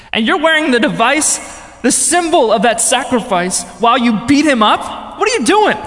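high, loud, building to a shout; re-enacted righteous indignation; outraged rebuke. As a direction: confront him in disbelief, climbing to a yell. Retold rebuke of gang leader.

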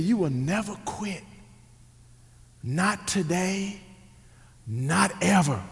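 slow, soft, measured refusal; quiet stern father. Retold or imitated. Father telling his son not to quit, quiet and firm. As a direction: lay it down softly, with long pauses.

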